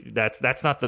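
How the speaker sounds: ordinary talk, nothing marked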